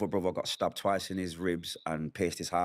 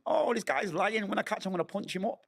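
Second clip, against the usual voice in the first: angry thought; 12 semitones higher than usual. Retold or imitated. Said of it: "So I'm thinking".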